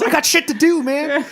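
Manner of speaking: very high, loud protest; hammed-up, squealing indignation; mock exasperation. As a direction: yell in comic frustration. [name] protests at waiting.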